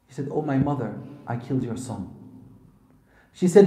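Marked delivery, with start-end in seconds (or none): 0.3-2.1 low, soft confession, retold; subdued confession. Son confessing to his mother, subdued. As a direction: drop the voice as if owning up to guilt.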